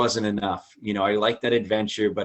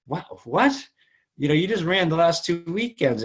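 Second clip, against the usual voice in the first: wife's exasperated voice; 8 semitones higher than usual. Retold or imitated.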